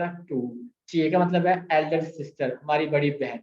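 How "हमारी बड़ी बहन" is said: usual speaking voice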